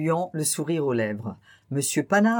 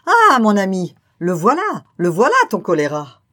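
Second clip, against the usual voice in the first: louder, higher exclaiming; wife crying out at her husband in a fit of giggles; cry out through laughter; 3 semitones higher, 8 dB louder than usual. A narrator's reading. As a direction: burst out at her husband, shaking with amusement. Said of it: "saisie d'un fou rire, s'écria".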